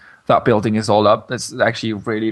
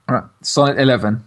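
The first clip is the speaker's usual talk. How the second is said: normal talking voice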